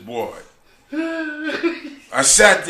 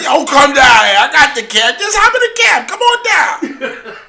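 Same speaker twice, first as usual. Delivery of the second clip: mock urgent calling; 11 semitones higher, 10 dB louder than usual. Tracy urging him down.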